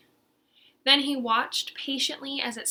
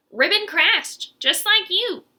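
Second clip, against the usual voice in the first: Aggie's voice; 8 semitones higher, 8 dB louder than usual. Acted. Aggie recounts the fall.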